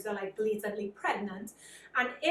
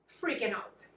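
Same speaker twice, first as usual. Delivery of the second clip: ordinary delivery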